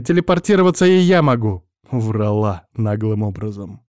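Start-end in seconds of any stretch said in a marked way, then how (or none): 0.0-1.6 raised, louder irritated boast. A narrator's reading. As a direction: snap back at him. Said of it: "показывала своё раздражение". (раздражённо)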